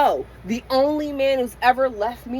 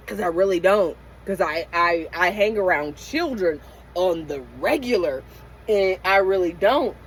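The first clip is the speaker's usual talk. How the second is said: ordinary delivery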